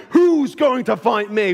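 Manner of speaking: slow, lightly voiced giant's challenge; hint of a taunting warrior; Goliath's taunt. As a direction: call it out as a mock giant. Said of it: "heard Goliath shout".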